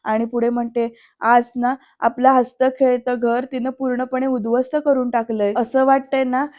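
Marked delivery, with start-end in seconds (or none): none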